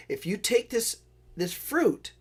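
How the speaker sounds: a hint of a sly witch tempting a boy; witch's offer.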